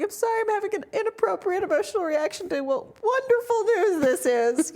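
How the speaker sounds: high, loud tearful apology, played for laughs; mock tearful. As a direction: act out an overwhelmed, weepy apology. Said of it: "I started crying out the sides of my eyes".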